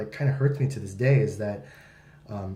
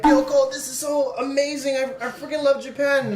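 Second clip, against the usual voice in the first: much higher, gushing tourist; gushing excitement; 12 semitones higher than usual. Retold or imitated. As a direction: gush about Japan. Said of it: "I was just like".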